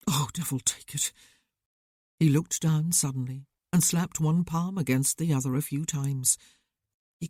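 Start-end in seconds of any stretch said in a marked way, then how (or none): 0.1-1.1 whispered curse under his breath. Acted. Devlin struggling not to collapse, whispered curse. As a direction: swear softly, fighting tears.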